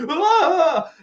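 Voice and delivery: sudden high yelp, hammed up; a comic shriek for Mel's shock; mock startled yell. Host imitating Mel's startled yell at Goose. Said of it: "have Mel look at Goose and be like".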